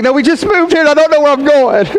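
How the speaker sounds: high-pitched imitation of a frantic wife; mock frantic wail. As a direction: blurt it out high, hammed-up desperation. Lost wife pleading with officer.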